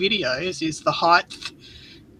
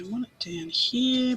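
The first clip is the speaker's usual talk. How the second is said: ordinary delivery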